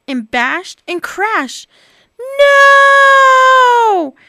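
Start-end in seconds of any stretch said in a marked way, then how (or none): 2.4-4.1 high, loud despairing wail. Acted. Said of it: "wailed Bob".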